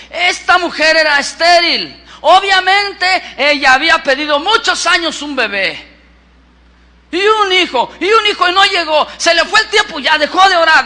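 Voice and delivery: impassioned, driving pulpit delivery; predicación enérgica. Real, not acted. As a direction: drive each point home forcefully. Predica a toda fuerza. (prédica apasionada y enfática)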